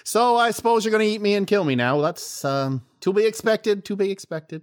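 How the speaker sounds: put-on a flounder drawling in a high southern accent; southern fish voice. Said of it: "he turned southern".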